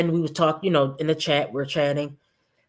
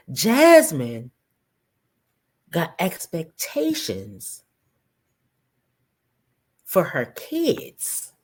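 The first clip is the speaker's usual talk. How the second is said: slow emphasis; slower than usual. Real, not acted.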